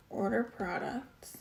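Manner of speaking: soft unvoiced whisper, slow. Real, not acted. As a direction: whisper it to yourself while writing. Muttering while writing on diagram.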